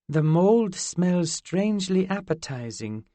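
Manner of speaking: put-on high, curious fairy girl. Zinnia eyeing pizza.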